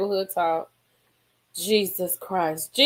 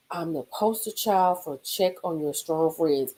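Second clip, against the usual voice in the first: ordinary talk, nothing marked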